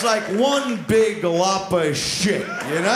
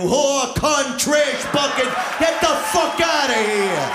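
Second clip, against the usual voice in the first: louder, higher furious insults; angry shout; 8 semitones higher, 5 dB louder, slower than usual. Acted. Man cursing a departed woman, furious shout. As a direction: spit the insults out. Abusive tirade after she leaves.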